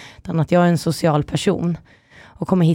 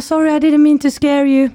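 flirting stranger; 6 semitones higher than usual. Retold or imitated. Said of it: "Han bara".

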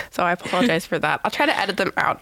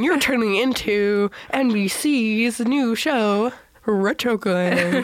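slow, drawn-out TV announcer; 2 semitones higher, much slower than usual. Pretend. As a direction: announce it slowly like a retro TV show. Mock TV show intro.

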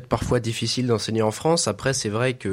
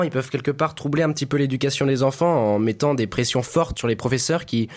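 usual speaking voice

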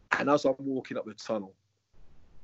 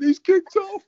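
much higher, louder panicked scream; 9 dB louder, slower than usual. Retold or imitated. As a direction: shriek it down the phone in alarm. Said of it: "Janet screaming on the phone".

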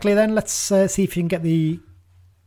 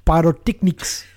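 a light, slow hint of a comic TV presenter; Alan Partridge; 3 semitones higher, 4 dB louder than usual. Host quoting Alan Partridge to co-host, lightly mimicked. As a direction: toss off the word like a pompous presenter, slowly. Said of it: "All I think of when I say it is Alan Partridge".

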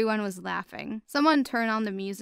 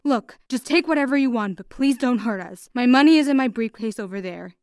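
louder, urgent begging; a hint of a frightened dad; pleading father; 3 semitones higher, 8 dB louder than usual. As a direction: beg them to spare the family. Father begs robbers.

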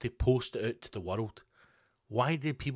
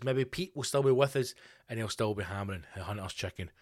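normal talking voice

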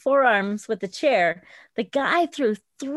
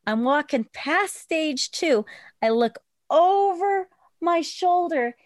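ordinary talk

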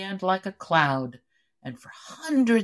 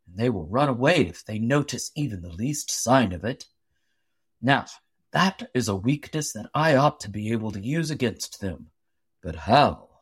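low, deliberate voice of a big thoughtful woodsman; Paul the giant logger; 6 semitones lower than usual. A narrator's reading. Paul thinks aloud about the Gumbaroos, low and musing. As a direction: lower your voice and think it through aloud.

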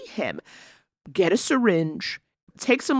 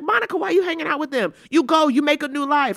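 fast, higher exasperated plea; exasperated scolding; 7 semitones higher, faster than usual. Real, not acted. Private school survivor pleading with Monica, fast exasperated plea. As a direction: urge her to get out, fast and high. Scolding movie character directly.

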